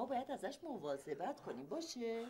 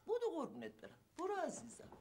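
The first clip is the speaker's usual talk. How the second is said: coaxing mother; 10 semitones higher than usual. Acted.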